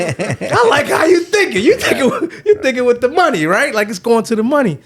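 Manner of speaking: high, loud, laughing through the quote; amused praise that breaks into laughter. Retold or imitated. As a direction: laugh while telling the kid he's thinking about money. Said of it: "So I'm like".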